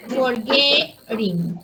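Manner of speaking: forgetting muy despacio, sílaba por sílaba; slow, drawn out. Shown as a demonstration. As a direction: stretch out forgetting slowly. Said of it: "vamos a decir". (forgetting dicho muy lento, marcando la sílaba GET)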